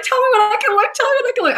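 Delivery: high-pitched squeamish squeal; mock frightened plea. Asking dad when to look, high mock fright. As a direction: plead as if hiding from the screen.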